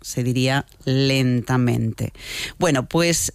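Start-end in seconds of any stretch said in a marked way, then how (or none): 0.8-2.1 "lentamente" stretched out, each syllable slow; said slowly. Shown as a demonstration. Host shows a word said the way it means, slowly. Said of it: "no se podría decir lentamente".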